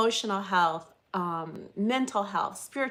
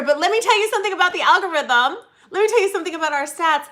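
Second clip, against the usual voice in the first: excited; 7 semitones higher, 5 dB louder, faster than usual. Real, not acted. Teasing lead-in about algorithm.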